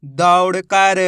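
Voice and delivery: "दौड़ कर" joined up, stretched and loud; blended word, drawn out. Shown as a demonstration. Teacher joins the letters for learners, drawn-out blended word. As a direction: draw out "दौड़ कर" as one word. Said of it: "तो मिलके बन जाएगा".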